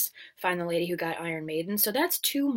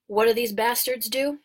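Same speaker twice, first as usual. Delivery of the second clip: usual speaking voice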